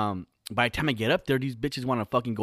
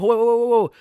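much higher and louder, hammed-up alarm; loud mock whoa; 11 semitones higher, 10 dB louder than usual. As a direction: shout it like slamming the brakes. Sudden halt mid-sex story.

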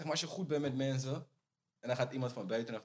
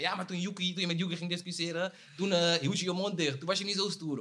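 taunting quote; 7 semitones higher, 4 dB louder than usual. Retold or imitated. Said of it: "Ga ik zeggen".

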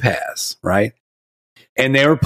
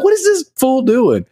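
amused disbelief; 12 semitones higher than usual. Retold or imitated. Parents reacting to video.

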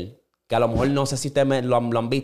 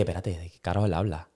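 stunned mutter; 8 semitones lower, 8 dB quieter than usual. Retold or imitated. Retelling his confusion at the twist, low and soft. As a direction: lower and softer puzzled murmur. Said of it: "Yo dije".

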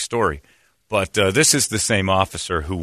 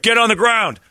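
high, loud imitated order; barked police command; 14 semitones higher, 5 dB louder than usual. Officer ordering him down, imitated in a raised shout. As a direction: snap out a mock arrest order.

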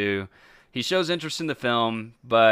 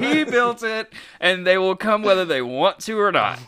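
laughing punchline; 8 semitones higher, 3 dB louder than usual. Pretend. Field of Dreams riff.

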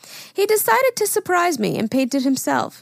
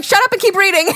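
retold yell; 8 semitones higher, 7 dB louder, faster than usual. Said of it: "I'm like".

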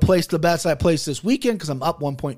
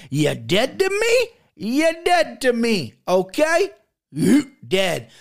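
slow, higher put-on tough-guy voice; mock tough breakup; 6 semitones higher, slower than usual. Talker telling FanDuel they are dead to him in a hammed-up voice. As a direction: drawl it out like dumping an ex.

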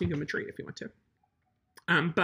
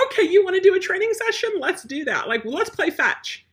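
much higher, louder, cheery "Oh, so you want a training session?"; upbeat mock invitation; 11 semitones higher, 6 dB louder than usual. Trainer talking to an obnoxious dog, high and cheery. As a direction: sweetly call the bratty dog's bluff. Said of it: "if you have a puppy".

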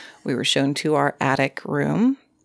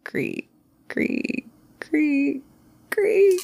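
quiet creaking; 12 semitones higher, much slower than usual. Retold or imitated. Lying in bed hearing stairs, imitated creaking. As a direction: imitate slow, spaced creaks in the night. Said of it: "start hearing some quiet".

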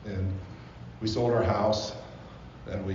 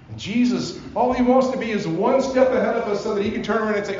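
full-force preaching; 10 semitones higher, 7 dB louder than usual. Acted. Pastor preaching to the congregation about God's love, impassioned.

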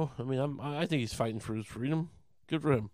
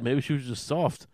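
ordinary talk, nothing marked

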